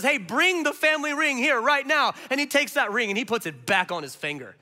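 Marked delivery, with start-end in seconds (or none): 0.0-2.1 higher, louder, eager order from the father; joyful father's command. Retold or imitated. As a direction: call out for the ring at once. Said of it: "He says".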